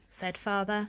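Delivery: ordinary delivery, nothing marked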